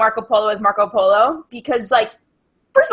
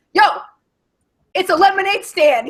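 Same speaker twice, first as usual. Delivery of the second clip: mildly indignant, higher-pitched outburst; put-on irritation, raised pitch; mock pissed-off; 7 semitones higher, 3 dB louder than usual. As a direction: imitate an annoyed dad setting people straight. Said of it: "He's, like, all pissed about it".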